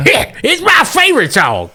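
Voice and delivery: put-on high, cackling old prospector voice. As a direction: chuckle through it like an old miner. Wilbur voiced by the GM.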